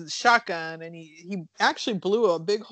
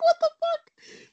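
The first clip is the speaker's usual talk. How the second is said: shocked outburst; 5 dB louder, slower than usual. Retold or imitated. Audience shocked by creature attack.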